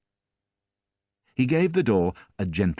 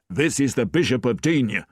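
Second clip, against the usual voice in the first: stern policeman; 6 semitones higher, 3 dB louder than usual. A narrator's reading. Policeman declaring.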